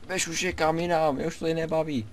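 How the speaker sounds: high whiny mock viewer voice; bored viewers complaining. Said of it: "už na mě křičíte". (vysoký kňouravý hlas znuděných diváků)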